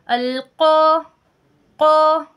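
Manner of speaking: loud, sustained "क़ा" with a thick rounded back q; full, heavy qaf. Shown as a demonstration. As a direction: model "क़ा" full-mouthed and drawn out. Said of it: "इसको हम पुर पढ़ रहे हैं".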